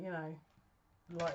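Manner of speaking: ordinary talk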